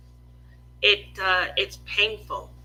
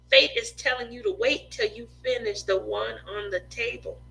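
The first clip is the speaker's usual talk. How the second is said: normal talking voice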